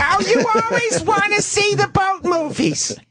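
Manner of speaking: high, nagging wife voice. Acted. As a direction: scold him like a tired wife. Mock wife scolding old man.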